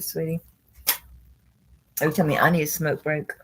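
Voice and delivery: ordinary talk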